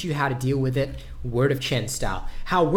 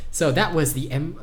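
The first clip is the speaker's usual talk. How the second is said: usual speaking voice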